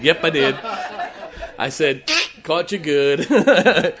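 loud laughing gloat; teasing laugh. Retold or imitated. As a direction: crow at her playfully.

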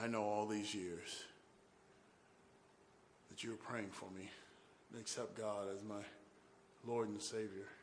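very soft, low, halting; subdued confession. Retold or imitated. Brother telling his praying brother he accepted God, hushed sincerity. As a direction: confide it quietly, phrase by phrase.

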